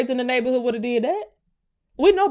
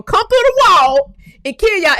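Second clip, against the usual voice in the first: loud rant; 8 semitones higher, 7 dB louder than usual. Real, not acted. Rant peaks.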